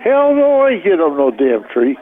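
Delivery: ordinary talk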